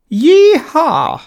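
loud, high posh cowboy yell; posh cowboy shout. A narrator's reading. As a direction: whoop it like a posh cowboy on horseback. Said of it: "shouted Terence in a strange posh cowboy type accent".

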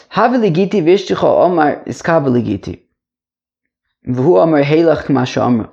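Talmud study chant; sing-song reading of the Aramaic text; teacher to class. Shown as a demonstration.

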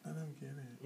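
put-on soft, breathy little old lady; sweet old woman voice. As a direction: imitate a sweet old lady, gently confused. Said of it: "sweet, like, old woman voice".

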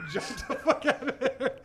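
high laughter spilling into slow words; laughing it out. Real, not acted. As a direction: giggle through the line.

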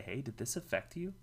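normal talking voice

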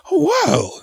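a lady shocked at the table, hammed-up; mock pearl-clutching. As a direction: play the shocked Southern lady. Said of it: "She starts clutching her pearls".

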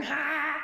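made-up emu battle cry; guessed-at bird war cry; imitated emu cry. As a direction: charge like a troop of emus. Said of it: "or whatever they sound like".

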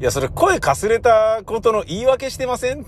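high-pitched, disbelieving challenge; incredulous mock accusation; mock skeptical retort. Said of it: "みたいにしか思えなくて".

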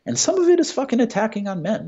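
fast, high, heated rant; heated outburst. Real, not acted. Heated rant about attacks on men.

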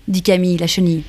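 ordinary delivery, nothing marked

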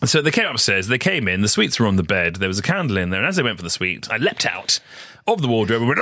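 ordinary talk

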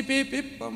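high-pitched "pi, pi" beeping; imitated detector beeps. Pastor mimicking the airport metal detector going off, high beeps. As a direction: beep like an alarm going off.

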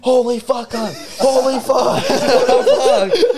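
high, hammed-up disbelief, breaking into laughter; shocked exclamation. Passenger looking back at the crash, retold shock. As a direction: cry out stunned at what you see.

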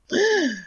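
sharp, high intake of breath; shocked gasp. Real, not acted. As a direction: gasp at startling news. Reacts to dog stolen by eagle.

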